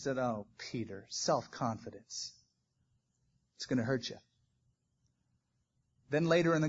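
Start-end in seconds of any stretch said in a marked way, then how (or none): 0.2-4.2 much softer, tender caution; gentle warning. Acted. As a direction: warn him gently, like a sad friend. Jesus gently warns Peter.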